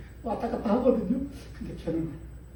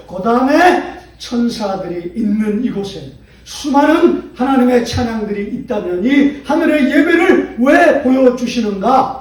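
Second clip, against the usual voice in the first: loud, slow, soaring sermon climax; full-force preaching; 5 semitones higher, 12 dB louder, slower than usual. Acted. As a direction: preach it out to the whole church. (힘찬 설교)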